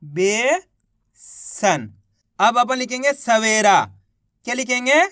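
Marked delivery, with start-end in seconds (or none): 0.0-1.9 slow drawn-out word. Shown as a demonstration. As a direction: stretch बेसन out very slowly. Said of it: "तो मिलके बन गया". (बेसन बहुत धीरे खींचकर)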